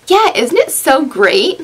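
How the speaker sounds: mock cheerfulness, slowly; sarcastic. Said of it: "snarky and sarcastic".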